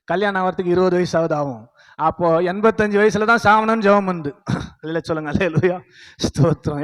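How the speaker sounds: normal talking voice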